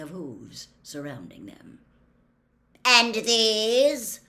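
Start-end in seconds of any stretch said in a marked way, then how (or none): 2.8-4.2 loud, higher questioning call; hard centaur. A narrator's reading. Gray centaur holding Hermione asks about the captives, loud and hard. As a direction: demand it loudly as a stern centaur. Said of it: "said the hard-faced gray centaur".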